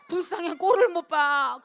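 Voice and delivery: loud, slow cry of pity; pitying exclaim. Retold or imitated. Drunk classmates pitying each other, loud and slow.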